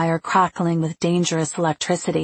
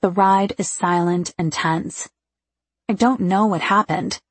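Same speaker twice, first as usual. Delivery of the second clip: ordinary delivery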